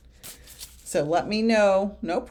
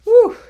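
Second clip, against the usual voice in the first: loud relieved whew; 15 dB louder than usual. Real, not acted. Relief after an emotional moment.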